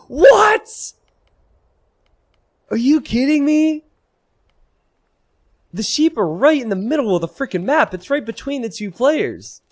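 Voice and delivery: high, loud incredulous shout; shocked disbelief. Real, not acted. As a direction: cry out in disbelief. Sheep found in the middle.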